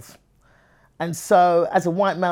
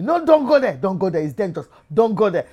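put-on urgent Nigerian-accented warning, loud high bursts; mock alarm in a Nigerian accent; 4 semitones higher, 6 dB louder than usual. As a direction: imitate alarmed Nigerians forbidding a trip. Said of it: "other Nigerians telling me".

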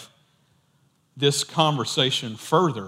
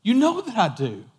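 higher-pitched, exasperated insistence; imitated frustrated pleading; 6 semitones higher than usual. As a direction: act out Peter's frustration at being asked. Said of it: "He gets frustrated, and he's like".

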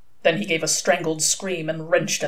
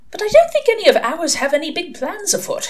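higher-pitched angel, earnest; lightly fussy angel voice, raised; Aziraphale; 12 semitones higher than usual. A narrator's reading. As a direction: protest mildly as a high-voiced angel.

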